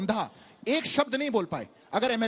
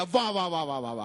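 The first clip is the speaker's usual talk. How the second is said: mock praise, each wah dropping lower and fading; sarcastic wah wah; 8 semitones lower than usual. Real, not acted. Debater mocking his opponent's claim with sarcastic praise.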